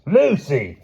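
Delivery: loud, high call across water; shout. Acted. Wilby shouting across the stream to Lucy. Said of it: "he shouted".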